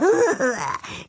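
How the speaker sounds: high gloating cackle; spiteful skull cackle. A narrator's reading. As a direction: cackle with malicious glee. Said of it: "kicherte der Schädel hämisch".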